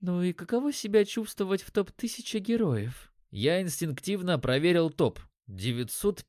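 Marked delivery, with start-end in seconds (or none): 0.0-3.1 high-pitched female character voice; curious elf woman. A narrator's reading. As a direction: ask out of the blue in a high voice. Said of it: "она неожиданно спросила".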